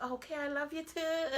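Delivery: high, sing-song mock of a gullible girl; mock naive reply. Mocking her younger self answering a man's sweet talk, high and sing-song. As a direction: play the dumb lovestruck girl saying it back. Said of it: "you'll sit back".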